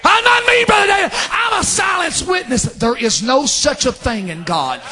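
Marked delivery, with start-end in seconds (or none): 0.0-2.6 outburst in preaching. Real, not acted. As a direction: burst out high at full force. Preacher bursting into a shout.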